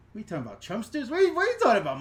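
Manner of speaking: ordinary talk, nothing marked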